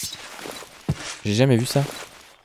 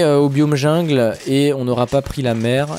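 normal talking voice

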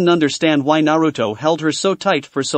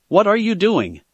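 demanding; 5 semitones higher than usual. Acted. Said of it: "Sakura demanded".

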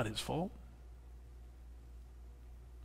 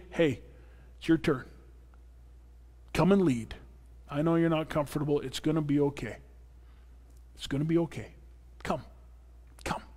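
soft, reassuring invitation, softer; gentle encouraging call; 8 dB quieter, faster than usual. Retold or imitated. Pastor inviting a hesitant volunteer to lead, gently reassuring. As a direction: coax them in kindly, quietly. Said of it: "equipped to call you and say".

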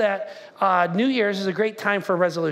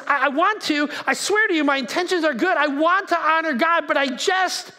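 high, insistent protest; acted earnest pleading; 5 semitones higher than usual. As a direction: plead your case with raised pitch. Paul pleading his good intentions.

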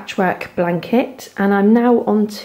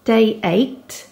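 usual speaking voice

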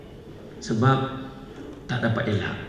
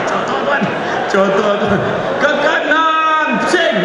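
laughing mockery; 11 semitones higher, 5 dB louder than usual. Mocking a mimed greeting, laughing.

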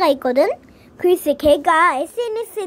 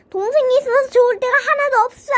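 high, fast complaint; frustrated outburst; 5 semitones higher, faster than usual. Acted. Brother complaining about having a sister, frustrated. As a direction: rush it out in a high, fed-up voice.

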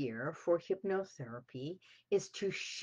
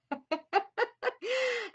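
delighted laugh; 3 dB louder than usual. Real, not acted. Delighted at the guest.

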